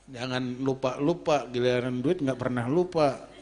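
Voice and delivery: low, grumbled nagging at the wife; grumbling. Retold or imitated. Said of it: "Kadang saya suka ngedumel". (ngedumel dengan nada rendah)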